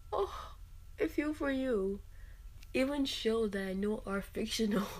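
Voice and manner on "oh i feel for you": high-pitched, tender cooing; acted-out pity, pitched way up; gushing sympathy. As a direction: coo it as if your heart aches for them. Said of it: "a lot more empathetic".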